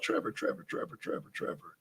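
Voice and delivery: soft whisper, searching memory; whispering a name. Real, not acted. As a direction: breathe the name over and over, trying to recall. Muttering a half-remembered name.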